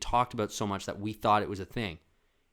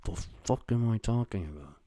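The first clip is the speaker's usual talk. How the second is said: usual speaking voice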